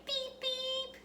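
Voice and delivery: high, soft toy-car beep; car horn beep. Shown as a demonstration. Teacher playing car horn for kids, high and soft. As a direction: squeak it like a little car horn.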